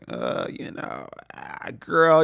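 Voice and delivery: mock reluctance. Imitating daughter dodging her mother's questions, reluctant.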